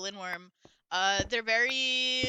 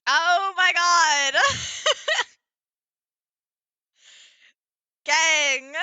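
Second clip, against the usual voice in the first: excited shriek; 7 semitones higher, 10 dB louder, much slower than usual. Real, not acted. Shrieking at a surprise.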